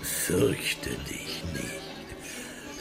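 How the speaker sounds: slow, eerie whisper. Acted. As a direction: hush the boy, mysteriously. Wahrsager flüstert geheimnisvoll. (langsames, unheimliches Flüstern)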